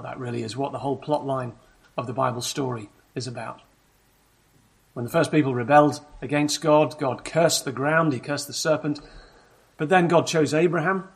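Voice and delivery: usual speaking voice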